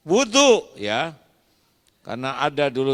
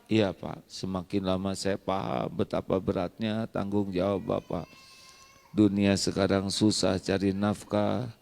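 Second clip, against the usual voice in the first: gentle reconciliation; 7 semitones lower, 7 dB quieter than usual. Acted. Soft reconciled couple dialogue.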